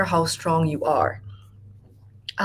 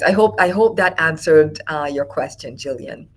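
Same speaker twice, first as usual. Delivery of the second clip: ordinary talk, nothing marked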